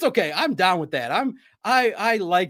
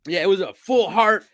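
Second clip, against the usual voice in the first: ordinary talk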